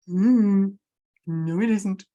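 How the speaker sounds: ordinary delivery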